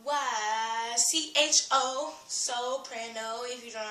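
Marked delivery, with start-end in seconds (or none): 0.0-2.1 slow spelling. Shown as a demonstration. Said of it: "how to spell".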